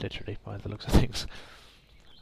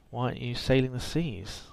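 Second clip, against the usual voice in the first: ordinary talk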